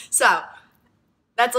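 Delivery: ordinary talk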